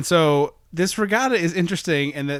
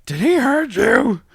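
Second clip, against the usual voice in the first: high, slow, shrill-free squeak of worry; high put-on voice; 10 semitones higher, 6 dB louder, slower than usual. Bobcat quoted, high far.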